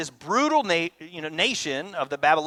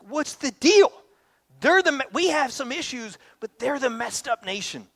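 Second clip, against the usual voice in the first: indignant protest; 9 semitones higher than usual. Retold or imitated. Habakkuk protesting to God like an indignant child. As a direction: protest the unfairness like the sulky kid. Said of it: "And Habakkuk's like".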